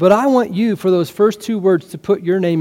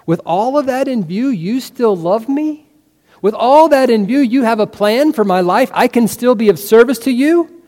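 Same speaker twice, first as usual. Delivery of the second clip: higher-pitched, incredulous questions; amazed gratitude; 6 semitones higher, 3 dB louder than usual. Acted. Believer thanking Jesus for still loving them, amazed. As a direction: marvel with raised pitch, questions lifting in wonder.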